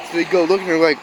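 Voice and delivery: ordinary talk, nothing marked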